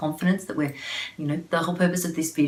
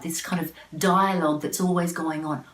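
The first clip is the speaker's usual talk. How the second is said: ordinary talk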